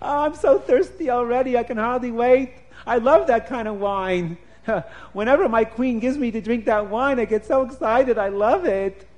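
high, loud, laughing with glee; giddy king who can't wait; thrilled royal chuckling with delight; excited king. Acted. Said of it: "the king started getting so excited".

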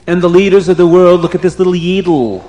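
plain reading voice

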